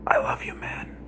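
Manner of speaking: soft breathy whisper into his ear. Retold or imitated. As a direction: hush it like a secret. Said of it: "whispers in your ear".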